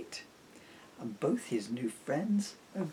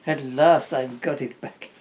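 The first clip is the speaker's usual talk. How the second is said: James Mason; 9 dB louder than usual. Acted. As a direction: play a suave old film star. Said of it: "pretending to be James Mason".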